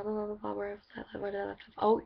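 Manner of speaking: ordinary talk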